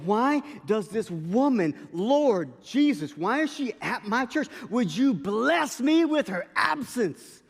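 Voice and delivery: loud, high, drawn-out plea to heaven; exasperated prayer. Retold or imitated. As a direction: wail a hammed-up complaint to the Lord. Retold exasperated prayer of complaint.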